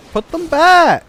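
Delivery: high, loud comic command; playfully outraged order; mock scolding. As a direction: call it out in exaggerated disgust. Scolding game creatures about tongues.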